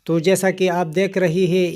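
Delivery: ordinary delivery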